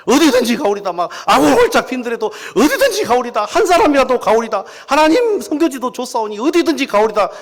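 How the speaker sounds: high, loud zealous prayer; hammed-up seminary-student devotion; imitating an eager young pastor's pledge; mock fervent vow.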